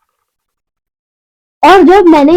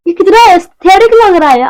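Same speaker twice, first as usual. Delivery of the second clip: excited, admiring exclamation; delighted; 6 semitones higher than usual. Real, not acted. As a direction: gush over how pretty it is. Admiring the product's bottle.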